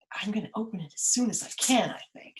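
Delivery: quick conspiratorial whisper; teacher whispering Pandora's plan. Acted.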